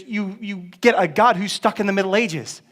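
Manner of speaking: usual speaking voice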